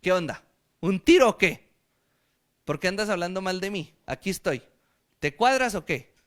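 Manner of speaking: cocky Mexican street talk, pushing to pick a fight; a swaggering Mexican tough calling Paul out; Mexican street slang. Acted. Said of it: "En lenguaje mexicano se le apareció".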